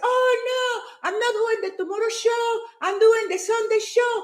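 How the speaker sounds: high-pitched acted refusal; flustered protest. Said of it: "I started doing my tripping".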